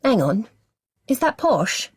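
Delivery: put-on high, questioning child voice; puzzled little girl. A narrator's reading. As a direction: ask it like a confused small girl. Little sister questions the accent.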